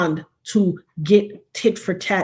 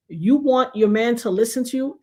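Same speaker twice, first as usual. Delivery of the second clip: ordinary talk, nothing marked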